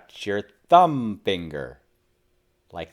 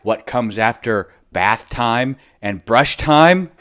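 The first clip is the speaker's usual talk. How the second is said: ordinary delivery, nothing marked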